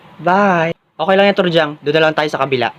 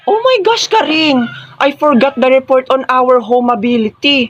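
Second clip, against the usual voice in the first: high, dramatic dismay that slows down; panicked realization; 4 semitones higher, slower than usual. Acted. Student telling Karing the report is forgotten, panicked. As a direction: open high, then draw out the confession.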